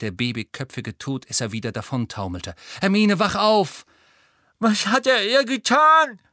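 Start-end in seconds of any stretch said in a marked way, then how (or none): 2.8-3.9 loud, higher, slower plea to wake; urgent call. Acted. Harry tries to wake Hermione. 4.6-6.2 put-on high, loud, slow boy's voice, worried; Neville, scared for his friend; anxious Neville. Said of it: "sagte Neville".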